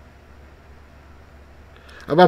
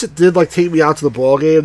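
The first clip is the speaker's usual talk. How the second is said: normal talking voice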